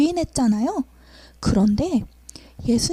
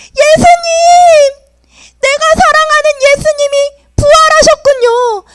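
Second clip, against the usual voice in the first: joyful cry; 12 semitones higher, 7 dB louder than usual. Acted. Peter recognises risen Jesus.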